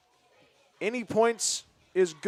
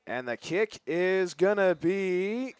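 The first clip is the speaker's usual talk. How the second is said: building field goal call; slower than usual. Real, not acted.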